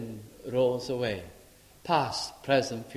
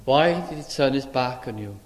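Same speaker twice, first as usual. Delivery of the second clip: ordinary talk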